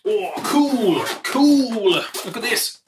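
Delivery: high, loud thrilled cool; delighted. Real, not acted. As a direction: burst out with real delight. Delighted by the new gear.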